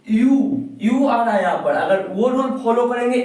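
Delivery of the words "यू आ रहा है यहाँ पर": ordinary talk, nothing marked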